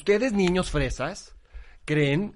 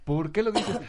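ordinary talk, nothing marked

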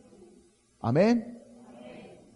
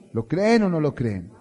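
louder repeat; 5 semitones higher, 6 dB louder than usual. Real, not acted. Pastor asks the congregation again, louder. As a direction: push the question louder the second time.

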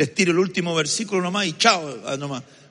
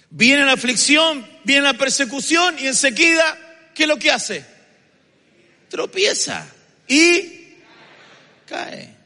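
building sermon cadence, slightly raised; rising preaching; 11 semitones higher, 7 dB louder than usual. Acted. Pastor warns the church of affliction, preaching with mounting force. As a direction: preach it, pressing each phrase.

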